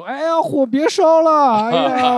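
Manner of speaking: high, loud, slow hammed-up fire panic; mock panic. Host mocks someone begging a fire to stop, hammed-up panic. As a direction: wail at the flames as a joke.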